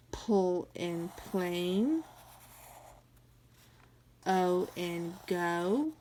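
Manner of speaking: each blend stretched slowly; slow sounding out. Shown as a demonstration. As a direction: model every blend slowly for the class. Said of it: "Let's do the next line".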